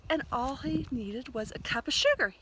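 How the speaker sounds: ordinary delivery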